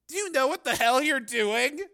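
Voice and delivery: loud, high incredulous question; alarmed challenge. A narrator's reading. Cameraman questions reporter's dangerous plan, alarmed challenge. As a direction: challenge your partner's judgement.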